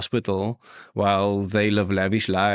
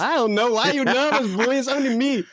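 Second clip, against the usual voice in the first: much higher playful tease; high teasing; 13 semitones higher than usual. Real, not acted. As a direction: tease him, pitched up. Teasing nervous friend.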